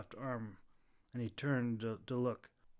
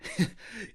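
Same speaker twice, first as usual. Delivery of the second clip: chuckle; 10 semitones higher, 3 dB louder than usual. A narrator's reading. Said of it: "The Clove chuckled".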